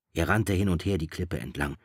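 usual speaking voice

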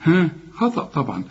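usual speaking voice

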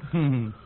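short mocking laugh; scornful laugh. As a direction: laugh him off with contempt. Scornful reaction to servile plea.

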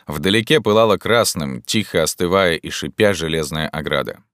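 ordinary delivery

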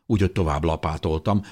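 ordinary delivery, nothing marked

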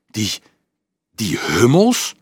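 slow, halting, open-mouthed disbelief; can't believe it's toddlers; stunned disbelief. A narrator's reading. Dolf hears little ones go to war, stunned. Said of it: "Met open mond staarde Dolf".

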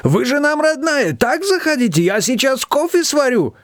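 put-on high-pitched effusive cry in a strong Georgian accent; lament-greet her, heavy Georgian accent; wailing, Georgian accent. A narrator's reading. Shushana greeting an unexpected guest, wailing with Georgian accent. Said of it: "запричитала Шушана с резким грузинским акцентом".

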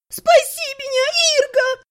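very high desperate cry; shrieking plea. A narrator's reading. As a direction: squeal for rescue. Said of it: "завизжала Элиса". (визгливая мольба)

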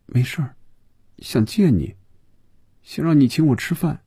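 put-on high, small girl's voice; girl's reply. A narrator's reading. Girl answers after dimmed eyes.

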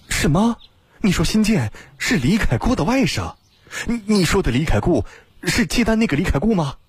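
high-pitched, stammering disbelief; shocked questioning. A narrator's reading. Shocked by news of the relation. (震惊追问，音调很高，略带结巴)

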